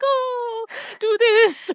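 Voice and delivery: squeaky high imitation; high-pitched playful mimic; high mock voice. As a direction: pitch it way up, mocking. Mimicking a character's request.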